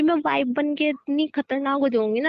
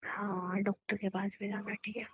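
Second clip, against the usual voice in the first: hushed; 3 semitones lower, 12 dB quieter than usual. Acted. Speaking low, people around her.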